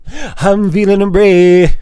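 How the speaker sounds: slow, drawn-out mock heroic brave; mock heroic declaration, drawn out; put-on brave voice. Shown as a demonstration. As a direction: ham up feeling brave, slowly. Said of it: "Damn it".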